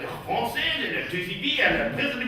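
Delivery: softly babbled French caricature, stumbling on repeated words; mock French. French local giving cathedral directions, mock French. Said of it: "always have to say their country".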